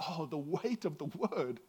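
soft, slow, awed whisper of realization; dropped to a hush, struck by the words. Retold or imitated. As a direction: let the weight land quietly. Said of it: "I was like".

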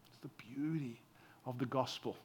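whispered awe; whispered remark on the gospel's beauty. Real, not acted. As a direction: breathe it out as a secret. Hushed closing thought.

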